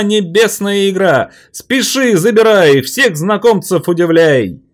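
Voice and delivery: very high, loud rhyming hawker cry; loud carnival barker. Acted. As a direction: sell the toy to the crowd. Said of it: "роль ярмарочного зазывала".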